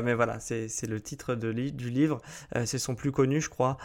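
ordinary talk

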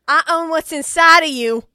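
acted angry retort. Boyfriend chasing his fleeing partner, loud angry retort. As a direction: belt out a possessive comeback. Acting Lou's retort in argument.

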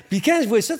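high, loud burst of laughter spilling into words; laughing. Real, not acted. Guest laughing at his own outburst with the host. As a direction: laugh it off, then keep talking.

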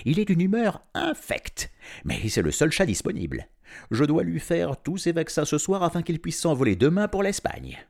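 voix nettement plus haute, un peu forte; il avertit Arif en rigolant; vétérinaire moqueur. A narrator's reading. (le vétérinaire à Arif, voix aiguë amusée)

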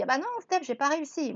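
lightly voiced whining; high, sheepish complaint; whiny excuse. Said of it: "tu me dis".

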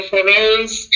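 ordinary delivery

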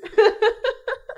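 high teary laugh; tearful laugh. Real, not acted. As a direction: laugh through sniffles. Crying, laughs it off.

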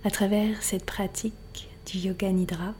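unhurried relaxation voice, letting silence settle; guided meditation. Acted. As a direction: guide the listener gently into rest, phrase by phrase. Leads a yoga nidra relaxation.

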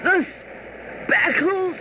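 high, strained, gasping dying cry; strangled voice. Acted. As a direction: choke the words out, high and desperate. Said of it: "in a strangled voice cried out".

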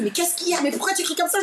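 high-pitched mock alarmed cry; retold shouting. Boyfriend asking why she screams, mock alarmed shout. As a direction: imitate a startled partner crying out, pitched high. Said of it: "Mon mec, il, qui crie".

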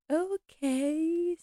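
high, soft, drawn-out resigned okay. Retold or imitated. Housekeeper yielding to the butler, softly resigned. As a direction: give in with a sigh. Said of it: "Missus is like".

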